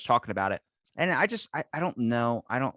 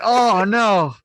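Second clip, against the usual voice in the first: alarmed oh no; 11 semitones higher, 11 dB louder than usual. Retold or imitated. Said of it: "I'm like".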